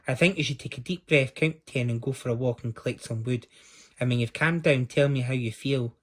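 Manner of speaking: usual speaking voice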